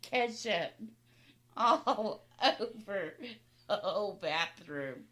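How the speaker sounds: words broken up by loud laughing. Real, not acted. Sister cracking up over the ketchup story, laughing. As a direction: tell it through laughter, slowly.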